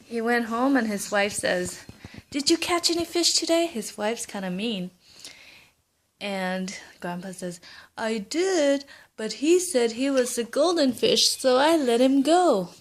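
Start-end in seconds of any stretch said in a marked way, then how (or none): 2.3-3.7 mean wife. Acted. Mean wife questions grandpa. 8.0-12.7 grandpa explaining. Acted. Grandpa answers his wife.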